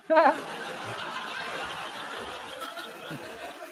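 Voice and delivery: long low mocking laugh; real gloating chuckle trailing off. Real, not acted. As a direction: laugh at them, drawn out and low.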